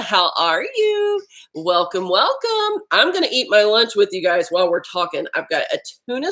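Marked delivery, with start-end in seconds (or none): 0.0-1.2 high, slow, stretched hello; sing-song greeting. Real, not acted. Greeting viewer joining live. 1.5-2.8 sing-song welcome. Real, not acted. Greeting viewer joining live.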